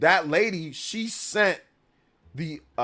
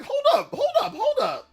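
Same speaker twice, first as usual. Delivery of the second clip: laughing disbelief. Retold or imitated. Retold confrontation with manager.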